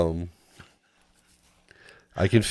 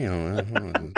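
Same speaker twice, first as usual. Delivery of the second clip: ordinary delivery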